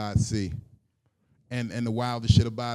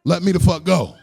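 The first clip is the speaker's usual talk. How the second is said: high, loud desperate holler; panicked yelling; 14 dB louder than usual. Acted. Man yells his safe word to be released, panicked shouting. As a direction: scream the safe word, desperate to get loose.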